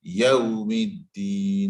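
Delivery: Yawmiddin slow, with a clear yaw glide; model recitation. Shown as a demonstration. Teacher modelling for a student, slow chanted Yawmiddin. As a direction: chant Yawmiddin with a long last vowel. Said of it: "It needs to be yow".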